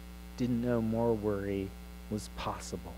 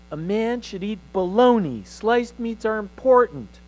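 much higher and louder, a mock-solemn decree; pompous boy; 9 semitones higher, 7 dB louder than usual. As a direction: proclaim it like a self-important young man. Boy declares manifesto to mother.

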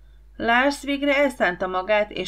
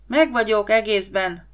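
plain reading voice

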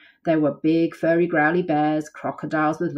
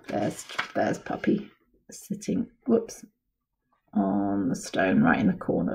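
whisper; 8 semitones lower than usual. Real, not acted. Aside to child.

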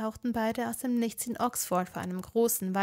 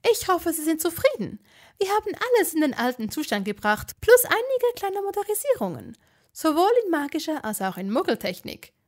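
higher, louder eager official witch; fussy, eager builder-witch welcoming guests; flustered ministry witch; 6 semitones higher, 7 dB louder than usual. A narrator's reading.

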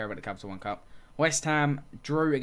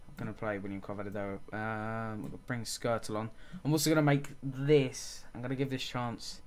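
normal talking voice

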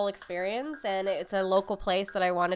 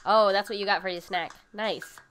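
ordinary talk, nothing marked

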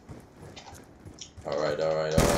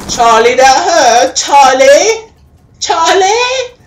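loud, high-pitched yelling at Charlie; pained shouting; 13 dB louder, slower than usual. Real, not acted. Gamer yells at Charlie for shooting him, pained shouting. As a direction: cry out in outraged hurt. Cries out after being shot.

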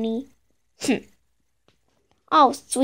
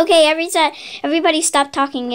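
ordinary talk, nothing marked

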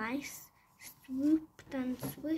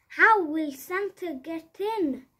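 wailing; 5 semitones higher, 11 dB louder than usual. Acted. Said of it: "they both wailed".

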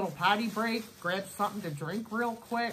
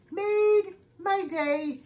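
high, slow squeaky echo of the words; playful high-pitched repeat; chipmunk voice; 9 semitones higher, 5 dB louder, much slower than usual. Shown as a demonstration. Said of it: "and your chipmunk voice".

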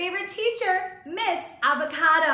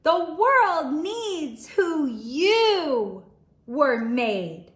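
gentle storybook read-aloud, lingering on the last lines; reading to children; 3 semitones lower, slower than usual. Acted. Teacher reads a picture book aloud to her young class. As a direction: read it like story time for little kids.

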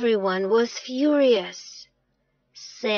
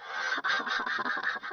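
long staccato chuckle; breathy mocking laugh; 8 dB quieter than usual. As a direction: laugh on and on in mischief. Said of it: "but Sam just laughed".

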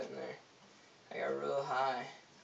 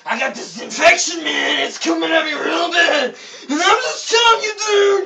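much higher, very loud crying shout; acting crazy; 12 semitones higher, 20 dB louder than usual. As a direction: wail it like a desperate madman. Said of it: "start acting crazy".

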